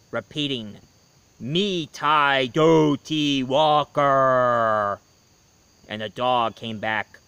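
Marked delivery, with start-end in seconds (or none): 1.4-5.0 slow, drawn-out spooky fireplace voice; eerie slow chant from the fireplace. A narrator's reading. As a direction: moan it out like a ghost from the chimney. The voice repeating.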